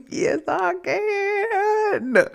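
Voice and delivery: high wailing laugh-cry. Real, not acted. Confessing sugar addiction, high laughing wail.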